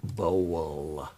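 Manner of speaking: "Bowl" with a long, rounded "oh"; overpronounced bowl. Shown as a demonstration. Streamer repeating the English pronunciation, drawn-out "Bowl". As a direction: stretch "Bowl" slowly through to the L. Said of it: "That's how we pronounce it in".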